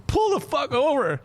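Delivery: very high, drawn-out exasperated yell; slow, stretched mock shriek; frantic outburst. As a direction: imitate a passenger at the end of his rope. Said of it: "he's having a fucking fit".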